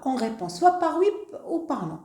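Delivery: ordinary delivery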